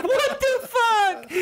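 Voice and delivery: high squealing disbelief, laughing; shocked what the fuck. Retold or imitated. Retelling his girlfriend's shocked reaction, high laughing squeal.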